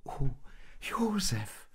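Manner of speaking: high, soft, breath-filled exclamation; touched exclamation. A narrator's reading. Maria learns of Josef's donkey gift, touched exclamation. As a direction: sigh the name in delighted surprise. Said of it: "rief Maria".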